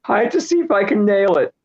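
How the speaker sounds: high, put-on British accent; mock British accent. Joking about always trying it, he slips into a high mock British accent. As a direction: overdo a British accent as a joke. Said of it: "screwing up the British accent".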